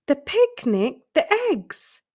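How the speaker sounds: the penny drops, climbing to a high yelp; sudden realisation. Acted. Peter suddenly remembers his hunt.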